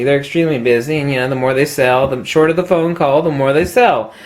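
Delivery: ordinary delivery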